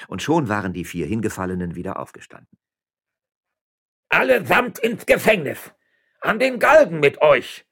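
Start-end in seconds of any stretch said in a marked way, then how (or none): 4.1-7.7 high, furious bellow; loud, enraged official's shout; roaring. A narrator's reading. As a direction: roar out the sentence in rage. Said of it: "brüllte Don Eugenio de Zúñiga".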